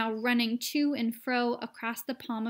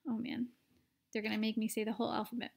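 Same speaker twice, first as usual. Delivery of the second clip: much softer, fast grumble; muttered aside; 6 dB quieter, much faster than usual. Real, not acted. Reader complaining about the long list, fast aside. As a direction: grumble under your breath.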